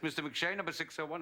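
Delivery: a hint of a clerk calling names. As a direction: call him in like an audition clerk. Audition name being called out.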